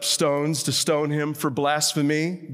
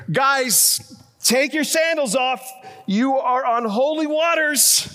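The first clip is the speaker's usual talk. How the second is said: high, raised mock proclamation; mock calling out; 8 semitones higher, 3 dB louder than usual. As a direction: playfully holler it as Jesus to the disciples. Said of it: "Jesus could have said here".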